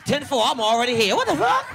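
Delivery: loud, high mock-excited radio reply; hammed-up eagerness on the police radio. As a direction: play a cop already on the scene, rising to a yelp.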